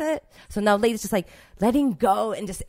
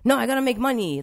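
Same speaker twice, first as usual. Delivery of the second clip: loud, higher, insistent; parents' pushy voice; 5 semitones higher, 9 dB louder than usual. Retold or imitated. Imitating her parents insisting she must work and earn, loudly. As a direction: bark out the parents' rule, mock-stern. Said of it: "that's how my parents were".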